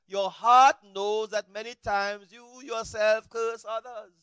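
weighty scripture reading. Acted.

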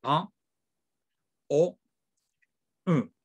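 "A", "O", "U" as French nasal vowels; French nasal vowel drill. Shown as a demonstration. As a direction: hum each vowel through the nose, French nasal. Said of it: "la nasal A".

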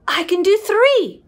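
usual speaking voice

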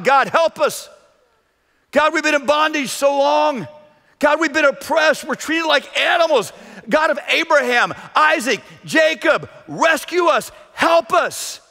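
an oppressed Hebrew pleading with God to rescue him; desperate prayer. Acted. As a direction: beg God from the depths of bondage. Hebrew slave crying to God.

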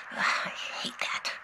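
breathy whispered complaint after a sigh. Real, not acted. Scientist alone on tape, whispering in frustration.

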